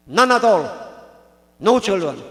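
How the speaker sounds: loud, forceful verdict, falling at the end; emphatic pronouncement. Acted. Swami delivers the Brahman's fate, emphatic pronouncement. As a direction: declare it hard, with finality.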